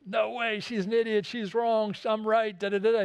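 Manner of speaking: high-pitched, huffy outburst, retold; mock the boss's stubborn protest; indignant refusal. CEO refuses to apologize.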